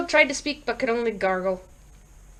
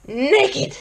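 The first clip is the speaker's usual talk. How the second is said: exasperated agreement; 5 semitones higher than usual. Acted. Harold exasperated, agreeing on the phone.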